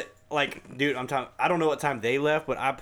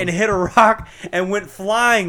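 louder, slow, urgent report; excited retelling; 5 semitones higher, 8 dB louder, slower than usual. Retold or imitated. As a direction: tell it breathlessly urgent. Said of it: "He's like".

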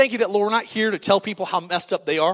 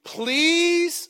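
mock pleading; 5 semitones higher, much slower than usual. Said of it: "he said this to Joseph".

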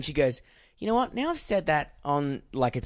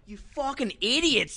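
higher, slow exasperated curse; mock road rage; 9 semitones higher than usual. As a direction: scold mates in the car. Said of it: "I'm like".

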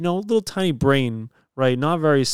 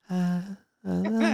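put-on higher baby babble with a smile; imitating baby; 6 semitones higher, 5 dB quieter than usual. Dad mimics his baby daughter's teasing coo, higher. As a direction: coo like a scheming infant. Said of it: "she just looks at me with a smile and goes".

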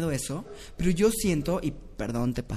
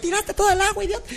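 mock scolding voice; 4 dB louder, faster than usual. Plays someone scolding the fall.

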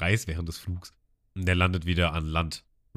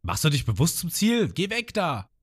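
scolding Sanji; 7 semitones higher than usual. Retold or imitated. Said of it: "Sanji auch".

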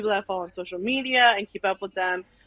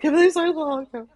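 high, loud urgent asking; re-enacted anxious demand; frantic question; 7 semitones higher, 6 dB louder than usual. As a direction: blurt it out in a panic to your sister.

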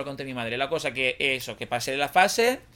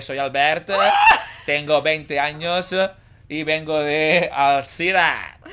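put-on loud, slow, laughing Valencian accent; 7 dB louder, much slower than usual. Re-enacting his memorised introduction, Valencian accent. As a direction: recite stiffly with a Valencian accent slipping out. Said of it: "me salió con acento".

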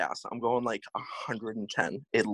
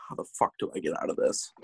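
fast, lower, breathy inner panic; inner thought; 8 semitones lower, 4 dB quieter, faster than usual. Retold or imitated. Panicking inside as the date searches for a place, whispered inner thought. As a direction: mutter it fast under your breath. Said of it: "thinking to myself".

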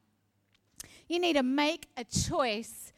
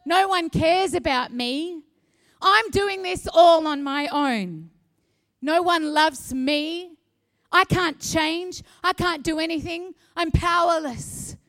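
voice of self-pity; 3 semitones higher, 8 dB louder than usual. Pretend. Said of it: "One of my favorites".

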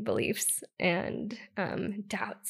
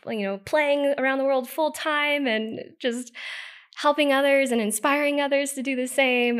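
excited; 5 semitones higher, 6 dB louder than usual. Real, not acted. Excited vision of her future.

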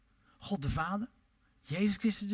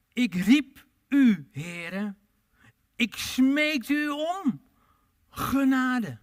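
very slow, louder, higher pleading prayer; pleading David; 6 semitones higher, 6 dB louder, much slower than usual. Retold or imitated. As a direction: beg for mercy, stretching each word. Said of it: "hij smeekte om genade".

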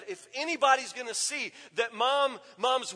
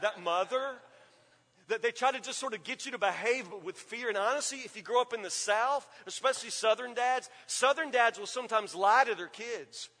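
ordinary delivery

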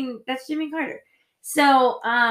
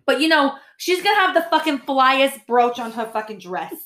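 put-on Queens Italian accent. Mocking a Queens Italian mom to podcast listeners, hammed up. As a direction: ham up a Queens Italian housewife. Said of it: "we talk like this like Italian women".

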